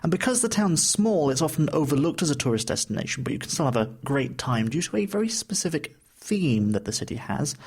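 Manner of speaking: normal talking voice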